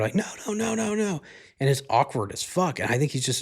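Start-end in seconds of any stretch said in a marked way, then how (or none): 0.1-1.2 very high, squealed no's without real volume; mock escalating refusal. Girlfriend standing up at dinner to deny it, mock high-pitched protest. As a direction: imitate her mounting refusal in a high voice. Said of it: "she just keeps louder and louder".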